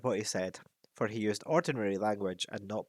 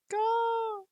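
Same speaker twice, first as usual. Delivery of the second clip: high sharp caw; a bird's croaking call; raven caw; 3 dB louder than usual. Acted. Raven caws between sentences, high sharp cry.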